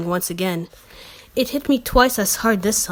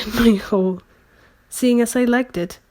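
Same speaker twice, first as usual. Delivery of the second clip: ordinary delivery, nothing marked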